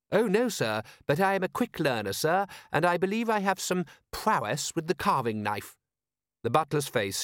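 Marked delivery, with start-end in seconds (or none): 0.1-5.8 high-pitched, proud manservant; a butler solemnly keen to enlist, pitched up; proud, deferential servant in a raised voice; eager patriotic butler. Acted.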